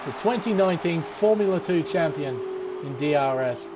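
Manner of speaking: usual speaking voice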